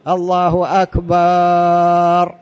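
"أكبر" sung slow with the first vowel stretched, as a wrong example; wrongly drawn-out adhan call. Shown as a demonstration. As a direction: chant "الله أكبر" slowly, stretching the a of "أكبر". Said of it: "هذا لحن".

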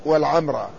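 والعمرة with a first, silent m, long ā; third variant. Shown as a demonstration. Lecturer listing three variants, والعمرة as wal-ʿamrā. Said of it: "فيها ثلاث لغات".

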